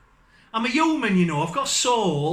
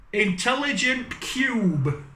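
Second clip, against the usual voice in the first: Intelligent Cube in a strange, slow put-on voice; slow the title down in an odd voice; funny accent; 4 semitones higher, slower than usual. Shown as a demonstration. Reading game names to viewers in a slow, strange put-on voice. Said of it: "in a really funny accent".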